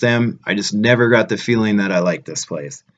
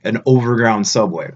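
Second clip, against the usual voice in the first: ordinary talk, nothing marked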